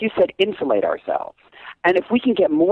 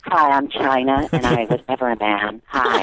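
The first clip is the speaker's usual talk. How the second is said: imitating China; 5 semitones lower than usual. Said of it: "you didn't know I could do China".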